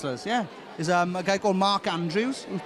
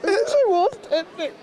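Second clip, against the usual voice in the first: high, louder laughing wail; laughing mock despair; 11 semitones higher, 3 dB louder than usual.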